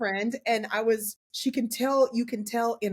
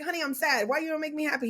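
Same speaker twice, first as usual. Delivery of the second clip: whiny wife; 7 semitones higher than usual. Retold or imitated. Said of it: "And I was like".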